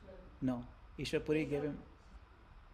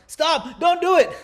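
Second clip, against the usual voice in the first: very high, very loud cry to stop; desperate shout; 14 semitones higher, 13 dB louder than usual. Acted. Villager in the crowd stops the haircut, desperate shout. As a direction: scream at the barber to stop. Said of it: "Someone shouts".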